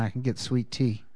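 ordinary talk, nothing marked

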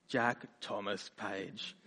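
low, slow, soft full-name scold; stern parent. Retold or imitated. Parents use his middle name.

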